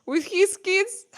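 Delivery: high, slow, incredulous question; surprised echo. Real, not acted. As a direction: repeat it back in disbelief.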